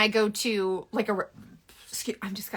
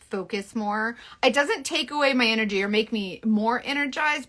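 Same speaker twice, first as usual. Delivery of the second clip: ordinary talk, nothing marked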